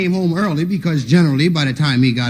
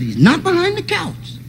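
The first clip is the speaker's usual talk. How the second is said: high sly uncle; 12 semitones higher than usual. Retold or imitated. Uncle's mock search.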